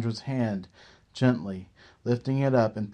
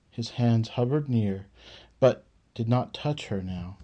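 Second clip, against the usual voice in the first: ordinary delivery, nothing marked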